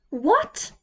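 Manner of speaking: high sudden shout of alarm. A narrator's reading. Pete shouting in shock at Hamish's news, high shout. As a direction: blurt it out, startled and high. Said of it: "shouted Pete".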